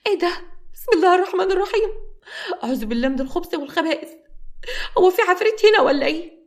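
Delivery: high, loud panicked praying; frightened shriek. Acted. Samra startled by a hand grabbing her, screaming in fright. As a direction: gasp out the prayers in sudden fright. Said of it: "سمرة اتفزعت جدًا".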